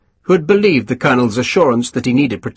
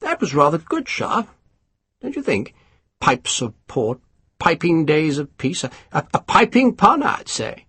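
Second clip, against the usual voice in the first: lightly voiced self-satisfied officer; a hint of a smug English colonel; pompous colonel; 5 semitones higher, 4 dB quieter than usual. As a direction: savour each word, pleased with yourself. Colonel admiring own pun.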